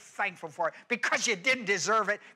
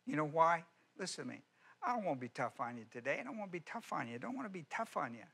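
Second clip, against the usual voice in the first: much softer, intimate appeal; soft pleading; 4 semitones lower, 7 dB quieter than usual. Acted. Pastor gently pleading with the congregation, much softer. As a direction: lean in close and plead gently.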